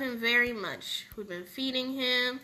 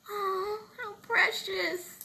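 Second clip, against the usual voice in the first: tearful; 7 semitones higher, slower than usual. Acted. Mother reunited with her baby.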